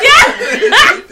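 high, loud shrieking laugh. Real, not acted. Cheering at story climax.

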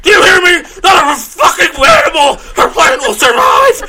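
put-on shrieking high furious old man; screaming boomer villain. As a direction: rant at the hero, screaming. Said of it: "did you like my boomer impression".

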